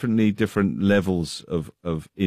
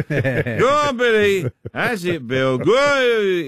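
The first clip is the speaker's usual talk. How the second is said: high-pitched heckler's cheer, drawn out; imitating a drunk heckler yelling from the crowd; drunken shouted encouragement; 12 semitones higher, 3 dB louder, much slower than usual. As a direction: shout out like a tipsy, overexcited fan. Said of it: "he kept shouting out".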